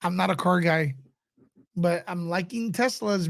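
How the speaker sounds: ordinary talk, nothing marked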